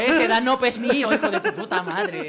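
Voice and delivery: loud, slow, drawn-out mock threat; mock bullying demand. Bully claiming another kid's yogurt, slow loud mock threat. Acts out lunch thief's threat.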